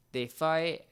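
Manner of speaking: ordinary delivery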